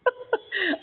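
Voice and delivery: a high, scornful burst of laughter; mocking laugh. Real, not acted. Debater laughs at his opponent's dodge, mocking laugh. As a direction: laugh the accusation off. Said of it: "آپ ہنس کیوں رہے ہیں اتنا؟".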